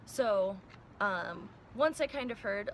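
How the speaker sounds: usual speaking voice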